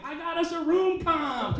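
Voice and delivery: high, slow gleeful brag; proud announcement. Retold or imitated. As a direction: announce it like a big win. Proud drunk friend brags, retold.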